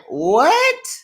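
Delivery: high, incredulous mock outrage; high-pitched disbelieving cast members; mock outraged cast. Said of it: "everybody's looking like".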